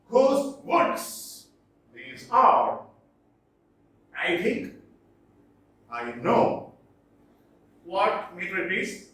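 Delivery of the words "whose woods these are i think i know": "Whose woods these are, I think, I know" in separated pairs, each second word stressed; iambic scansion. Shown as a demonstration. Lecturer reading an iambic example for students, iambic scansion. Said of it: "Example here".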